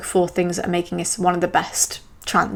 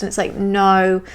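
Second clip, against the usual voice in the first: normal talking voice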